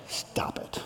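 whispered stop, not yelled; firm whisper. Retold or imitated. Parent holding a child's cheeks, firm whispered stop. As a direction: hold the child's gaze and whisper it firmly. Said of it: "You don't yell at them".

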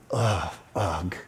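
low, heavy groan of displeasure; low demonstrative "ugh"; disgusted ugh. Shown as a demonstration. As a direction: groan it out to show the feeling. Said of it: "fosters the feeling of ugh".

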